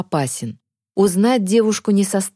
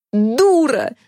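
higher-pitched, exasperated cry; angry yell; 5 semitones higher than usual. A narrator's reading. Boy lashing out at his companion, angry yell. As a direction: hurl the insult in a raised voice. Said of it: "завопил Захар".

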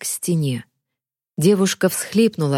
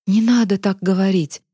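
ordinary delivery

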